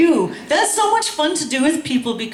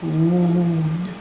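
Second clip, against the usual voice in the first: chanted call-and-response poem; spoken-word poet leading a crowd chant; poem performance; 2 semitones lower than usual. Acted. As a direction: lead the room through the chant. Leads audience through chanted poem.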